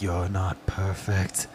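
low, slow creepy quote. Retold or imitated. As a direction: whisper it like a ghost. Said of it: "that one that's like".

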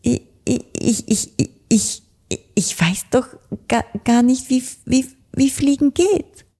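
can't get the words out for fear; stammering. A narrator's reading. Frightened blackbird stammers he cannot fly. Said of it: "stotterte Anton Amsel".

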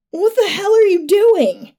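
high, drawn-out alarmed cry; stabbed man's shout. A narrator's reading. Stabbed man to his attacker, high horrified shout. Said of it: "he shouted".

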